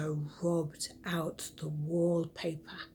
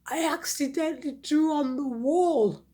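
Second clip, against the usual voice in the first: nearly crying; 8 semitones higher, 8 dB louder than usual. Acted. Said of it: "nearly started crying".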